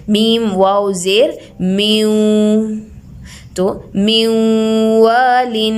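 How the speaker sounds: मीम वाव ज़ेर म्यू then म्यूवालिन drawn out with nasal hum; spelled out slowly. Shown as a demonstration. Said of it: "गुन्ने के साथ मिलाया जाएगा".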